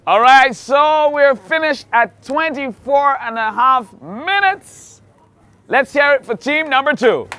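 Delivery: big hyped announcer voice; game show host. Acted. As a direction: sell it like a TV host. Announcing the finish.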